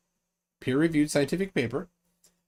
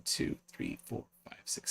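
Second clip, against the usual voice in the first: whispered counting; 14 dB quieter than usual. Real, not acted. Counting nominations under breath.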